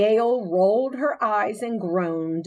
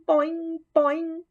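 higher springy boing copied; mimicked boing; 10 semitones higher than usual. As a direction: bounce it like a copycat kid. Kirk mimics the frog.

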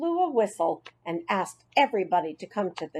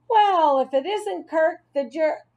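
mocking; 8 semitones higher, 4 dB louder than usual. Marty mocking Kirk.

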